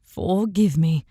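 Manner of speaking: a hint of sorrow in the apology; touch of sadness. A narrator's reading. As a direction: let a little sadness through. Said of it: "his voice held a touch of sadness".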